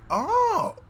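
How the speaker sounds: surprised. Real, not acted. Sudden discovery exclamation.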